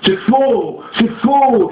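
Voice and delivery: high, protesting cry of denial. A narrator's reading. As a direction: shout that it's false. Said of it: "criait Tavillier".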